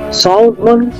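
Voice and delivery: normal talking voice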